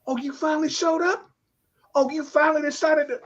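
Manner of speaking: high-pitched mock surprise; resentful sarcasm. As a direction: needle him with a high, sarcastic question. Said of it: "I'm carrying all this anger".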